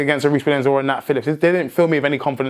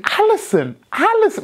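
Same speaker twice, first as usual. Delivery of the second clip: name called, rising much higher on the second; mock yell of the keeper's name; retold screaming. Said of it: "I was, like, screaming".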